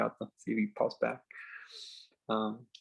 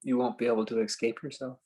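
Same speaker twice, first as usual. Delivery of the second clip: slow, spacious meditation guide with long pauses; calm phrases left hanging in silence; guided meditation; 3 dB quieter, slower than usual. Acted. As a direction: ease them into stillness, unhurried.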